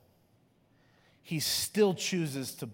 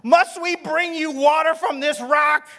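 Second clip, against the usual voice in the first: shouted rebuke; 12 semitones higher, 13 dB louder than usual. Retold or imitated. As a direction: yell it down at the rebels. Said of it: "he shouted".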